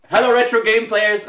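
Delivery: loud, high greeting; over-loud, high-pitched show opener; too loud. Real, not acted. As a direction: belt out the hello a bit too loudly. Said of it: "that was a little bit too loud".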